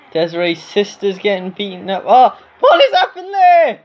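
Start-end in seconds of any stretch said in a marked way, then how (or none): 2.1-3.8 high, loud commentator shock; shocked outcry. Real, not acted. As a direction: cry out in astonishment at the action. Shocked by game event.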